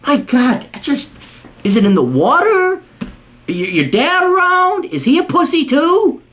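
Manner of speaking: high-pitched, exasperated questioning; incredulous outrage. Real, not acted. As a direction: let each question climb in disbelief. Incredulous angry rant.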